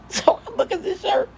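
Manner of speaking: high, laughing through the words; cracking up mid-line. Real, not acted. Mother laughing at her son's shirt remark, laughing.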